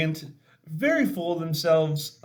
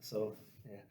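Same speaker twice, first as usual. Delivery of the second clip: almost gone, swallowed by emotion; real tears held back, words nearly vanishing; choked up; 5 semitones lower, 18 dB quieter than usual. Real, not acted. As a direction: trail off while holding back tears. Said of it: "I'm trying real hard not to cry".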